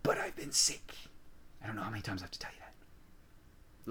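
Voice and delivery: hoarse whispered aside; whispering. Real, not acted. Aside about being sick.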